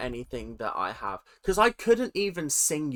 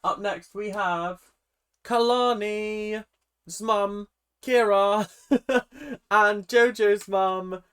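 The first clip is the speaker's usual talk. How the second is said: hyped-up announcement; 6 semitones higher, 5 dB louder, slower than usual. Acted. Announcing mums like a host.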